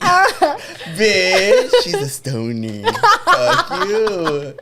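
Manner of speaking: high squealed banter through laughter; playful cursing while cracking up; loud, breaking into laughter; laughing hard. Real, not acted. Cracking up at friends' teasing.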